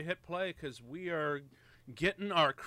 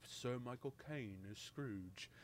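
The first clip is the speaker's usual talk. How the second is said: soft, low take on a famous actor; name the star in a hushed, lowered voice; Sir Michael Caine; 3 semitones lower, 13 dB quieter than usual. Retold or imitated. Movie fan announcing the star as Scrooge, mock reverent Michael Caine voice. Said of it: "you always gotta say it like that".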